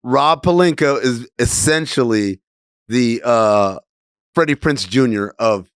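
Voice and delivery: ordinary talk, nothing marked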